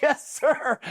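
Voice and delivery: very high strained recruit voice; comically high mock acknowledgement; recruit sounding off. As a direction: imitate a recruit straining out a reply. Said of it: "that recruit sounds off".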